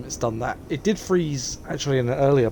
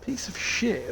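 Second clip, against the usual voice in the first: higher pitched, exasperated curse; real frustration; 10 semitones higher, 5 dB quieter, slower than usual. Real, not acted. Gamer cursing the game after it crashes again, higher and exasperated.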